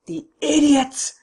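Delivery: high, loud, slow shout. Acted. Don Luis shouts in fury at the police. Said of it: "he shouted".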